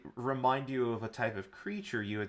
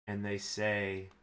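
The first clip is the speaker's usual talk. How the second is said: ordinary talk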